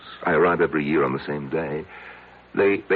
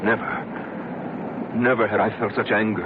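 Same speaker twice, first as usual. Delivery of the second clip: a whispered never, then the anger rising in the voice; seething anger; 4 semitones higher, slower than usual. Acted. Man telling the doctor about his rage, seething. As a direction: let the rage slip out through the words. Man recalls finding lovers in cave.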